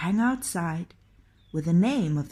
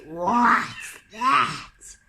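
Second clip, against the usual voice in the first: troll shout; 4 dB louder than usual. A narrator's reading. Trolls startled by the cat.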